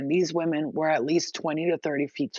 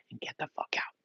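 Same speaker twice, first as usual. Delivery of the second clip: hushed, urgent whisper; 5 semitones lower, 12 dB quieter, faster than usual. Acted. Telling the enslaved how to escape, in a whisper. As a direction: drop to a whisper.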